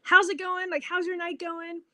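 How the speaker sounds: put-on light up and greet him like an old friend; bubbly greeting. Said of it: "I'm extremely bubbly".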